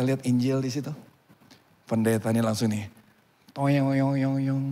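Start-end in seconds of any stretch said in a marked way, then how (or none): 3.5-4.7 cartoon wobbling boing sound; comic boing noise. Pastor is struck by a realization, comic boing. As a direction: voice a springy sound effect, dying away.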